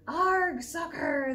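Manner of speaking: loud rowdy group shout; crew yell. A narrator's reading. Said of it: "the crew yelled".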